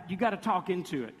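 ordinary delivery

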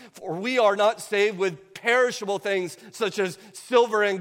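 fiery sermon voice, hammering each phrase; preaching at full force. Acted. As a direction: preach it like a warning from the pulpit.

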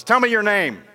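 loud, high commanding challenge; king rousing a soldier caught asleep, stern and loud. Acted. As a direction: bark it at the sleeping soldier. King demands name.

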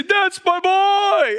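high, loud, stretched-out dad cheer; proud father's drawn-out shout; top-of-lungs yell. Retold or imitated. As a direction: holler it proudly from the sidelines. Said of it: "yelling at the top of his lungs".

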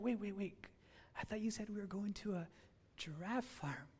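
quietly baffled; soft confused protest; bewildered disbelief. Retold or imitated. Speaker confused at rusty farm.

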